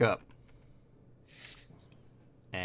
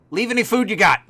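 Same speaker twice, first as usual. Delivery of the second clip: greedy kobold demand; 10 semitones higher, 7 dB louder than usual. Acted. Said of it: "the other one goes".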